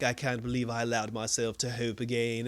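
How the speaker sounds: mopey dad. Retold or imitated. Retelling the despairing dad.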